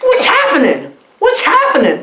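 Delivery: high-pitched comic panic; imitated high, startled cry; mock alarm. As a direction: repeat it in hammed-up bewilderment. Acted alarm at monster popping out.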